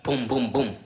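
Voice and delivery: loud, high booming knocks; imitated door knocking. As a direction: mimic hard bangs on a door.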